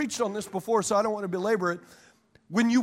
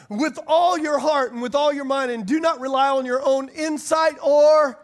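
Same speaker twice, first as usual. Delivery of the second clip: scripture reading; 5 semitones higher, 5 dB louder than usual. Acted. Reading Proverbs aloud.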